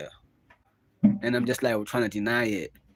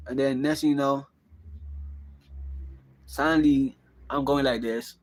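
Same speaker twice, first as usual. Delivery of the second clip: ordinary talk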